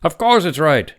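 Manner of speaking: loud, high, emphatic snap; heated retort. A narrator's reading. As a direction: cut him off with force. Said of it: "Doctor Webber's eyes flashed".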